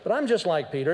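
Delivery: normal talking voice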